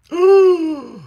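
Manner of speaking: high loud gasp of fake shock; big startled intake of breath; mock shocked gasp. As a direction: gasp as if scandalised. Reacting to brother's dare.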